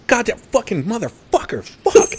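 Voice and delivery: high, sudden mock swearing fit; mock rage outburst. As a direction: explode out of nowhere at the game. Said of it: "out of nowhere you hear".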